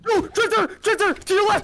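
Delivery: high urgent warning yell; panicked shout. Real, not acted. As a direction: shout the warning over chaos. Warning partner amid zombies.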